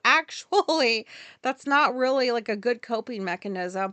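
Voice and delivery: imitated lecturing, stretched and loud; mock know-it-all correction. Mimics correcting the dude, refused.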